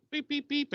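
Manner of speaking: high, thin beeping like a ringing phone; mock phone ring. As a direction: beep it out like a cell phone.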